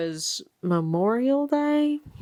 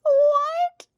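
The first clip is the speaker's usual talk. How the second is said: shocked scream; 9 semitones higher, much slower than usual. Real, not acted. Reader reacting to a twist, shrieking in disbelief. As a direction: cry out in shocked delight. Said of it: "screamed when I read this".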